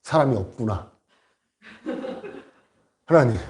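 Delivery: low, halting mock confession; imitated contrite prayer. Stranded pastor confessing to God, imitated humble prayer. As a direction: confess humbly, with pauses between faults. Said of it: "이 목사님이 기도를 하기 시작합니다".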